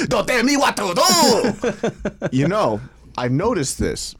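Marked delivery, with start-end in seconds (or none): 0.1-2.3 high, slow, hammed-up French accent; put-on French accent, playfully defiant; mock French accent. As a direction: protest in a drawn-out fake French accent. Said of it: "we were doing the French accent".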